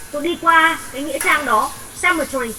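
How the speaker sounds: ordinary delivery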